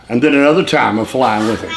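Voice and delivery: ordinary talk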